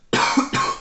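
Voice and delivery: a real rough cough; chesty cough. Real, not acted. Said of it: "Ciągle mnie ten kaszel trzyma".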